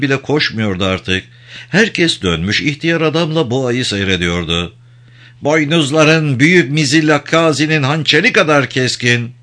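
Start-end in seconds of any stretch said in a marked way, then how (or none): 5.4-9.3 higher-pitched lilting character voice; coaxing, ceremonious flattery; admiring praise. A narrator's reading. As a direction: chant praises to a great bull, pitch lifted. Shadrach's praise chant to the bull.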